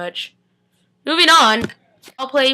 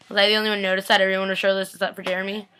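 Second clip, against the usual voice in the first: ordinary talk, nothing marked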